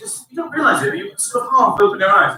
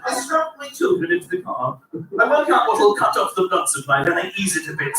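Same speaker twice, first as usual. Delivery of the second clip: usual speaking voice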